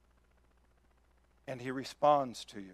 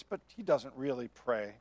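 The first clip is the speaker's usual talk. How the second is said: ordinary talk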